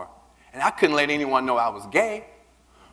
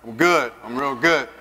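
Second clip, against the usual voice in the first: slow, cool show of confidence; cocky reply; 3 semitones lower, slower than usual. Retold or imitated. Telling Eric he is fine, slow cool swagger. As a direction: play it smooth and self-assured. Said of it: "And I was like".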